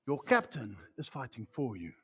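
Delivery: low, soft and reassuring; hushed assurance. Real, not acted. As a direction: drop right down to a quiet, sure statement. Preacher drops to reassure after shouting.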